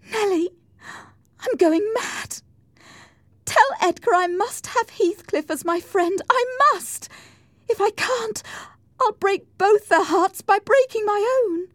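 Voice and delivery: high, overwrought plea; distraught. A narrator's reading. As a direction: plead desperately at a very high pitch. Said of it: "Cathy was in a state of shock".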